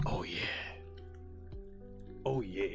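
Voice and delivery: low, near-whispered relish; hushed savouring. Pretend. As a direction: murmur it softly and low into the mic. Savouring seltzer can near the mic.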